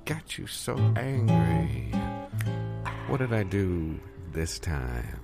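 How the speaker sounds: ordinary delivery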